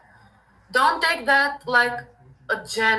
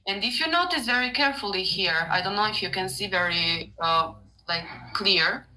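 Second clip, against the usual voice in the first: ordinary talk, nothing marked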